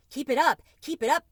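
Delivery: a tiny mouse squealing with glee; squeaky mouse. A narrator's reading. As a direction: mouse cheering the cricket on in a high squeak. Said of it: "squeaked Tucker Mouse".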